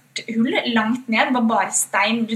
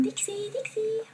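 calling the dog; 11 semitones higher, 10 dB quieter, slower than usual. Retold or imitated.

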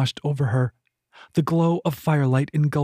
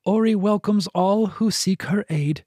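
a lightly raised, formal temple attendant's welcome; formal greeter; 6 semitones higher than usual. A narrator's reading. Temple attendant greeting soaked strangers, lightly voiced and formal. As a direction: greet them like a polite gatekeeper.

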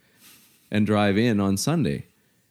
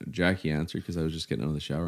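usual speaking voice

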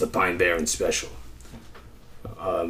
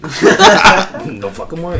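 laughing; 11 semitones higher, 13 dB louder, much slower than usual. Real, not acted. Laughing while dodging the question.

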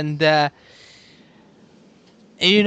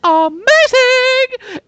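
high, loud, drawn-out cheer; excited; 9 dB louder, much slower than usual. Real, not acted. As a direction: stretch it out with huge excitement. Hyping next season.

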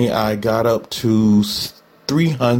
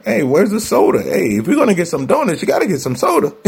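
higher, cheeky craving voice; body's cravings talking; 6 semitones higher than usual. Retold or imitated. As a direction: voice your body begging for donuts and soda. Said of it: "my body was like".

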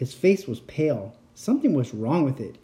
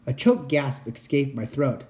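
usual speaking voice

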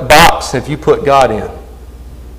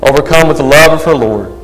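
dramatic story reading. Acted. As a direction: read the martyr story with gravity. Reads martyr story over music.

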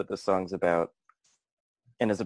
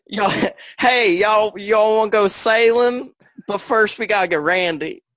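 high, loud Southern twang; brassy Texas girl calling out; Texas accent; 11 semitones higher, 7 dB louder than usual. Retold or imitated. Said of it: "This is my impression of a Texas girl".

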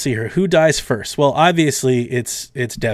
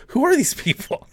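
put-on high, bewildered kid voice; mock Spider-Man; 13 semitones higher than usual. Spider-Man wonders about the strange team in a high mock voice. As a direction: ask it like a baffled teenager. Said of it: "Spider-Man's like".

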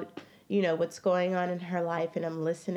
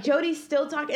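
normal talking voice

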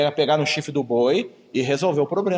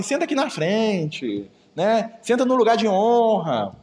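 slow, flattering and obsequious; fawning invitation; 5 semitones higher, much slower than usual. Retold or imitated. A churchgoer flattering a well-dressed visitor, slow and fawning. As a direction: usher the rich man to the front. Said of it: "E aí você fala assim".

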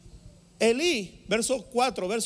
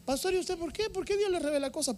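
much higher-pitched questioner's voice; imitated high, curious questioning; questioning church member; 12 semitones higher than usual. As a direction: put on a high voice quizzing the pastor.

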